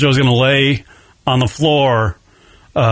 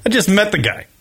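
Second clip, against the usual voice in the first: usual speaking voice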